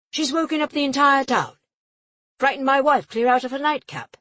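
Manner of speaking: a pompous official shouting his complaint; outraged official. A narrator's reading. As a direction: throw up your arms in outrage.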